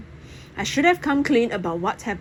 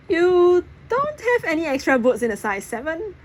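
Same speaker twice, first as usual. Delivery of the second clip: high, lightly playful ask; a touch of cheeky hope; hopeful question; 9 semitones higher, 4 dB louder than usual. A narrator's reading.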